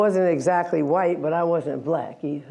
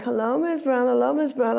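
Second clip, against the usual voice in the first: put-on higher, sing-song chorus of little girls; little girls greeting; 6 semitones higher than usual. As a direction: greet the new teacher like obedient children. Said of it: "And they all like".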